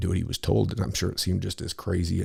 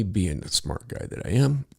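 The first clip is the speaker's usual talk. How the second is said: ordinary delivery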